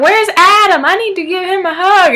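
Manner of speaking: put-on high, loud sing-out for a child; grandma calling out. Grandma looking for Adam to hug, high loud call.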